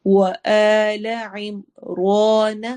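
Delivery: وآل عمران with a thick ر and a long آ; heavy ra. Shown as a demonstration. Teacher demonstrating وآل عمران, slow. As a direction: thicken the ر, stretch آل. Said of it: "را کو again موٹا کریں گے".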